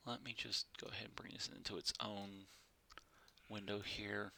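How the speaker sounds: fast whisper muttered to himself; whispered aside. Real, not acted. Streamer fiddling with windows, fast muttered whisper. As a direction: whisper it quickly under your breath. Muttering to self during setup.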